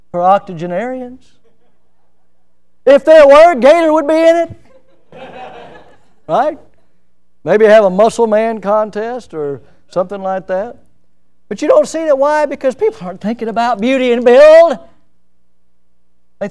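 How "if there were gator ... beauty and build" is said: high, loud lively preaching with a laugh; excited preaching. Acted. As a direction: play it for laughs from the pulpit. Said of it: "preaching climax".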